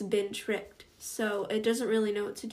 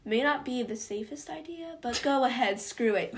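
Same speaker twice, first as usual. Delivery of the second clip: ordinary talk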